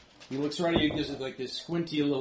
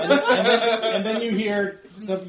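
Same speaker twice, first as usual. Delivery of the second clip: usual speaking voice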